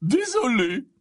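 put-on drunken, hiccupy sorry; hiccupping apology. A narrator's reading. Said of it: "s'excusa Slughorn avec un hoquet".

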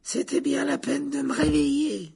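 very high, slow complaining voice; grumpy. A narrator's reading. Portrait lady grumbles at Harry for waking her, grumpily. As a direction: draw out a peevish high complaint. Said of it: "d'un ton grincheux".

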